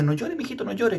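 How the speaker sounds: high-pitched mock soothing of a child; quick, high "there, there" voice; hushing adult. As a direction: imitate a grown-up telling a little one not to cry. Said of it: "que está llorando y le dice".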